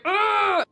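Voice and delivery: a loud, high, exasperated "ugh"; sharp groan of letdown; frustrated groan. Retold or imitated. Missing him at his office.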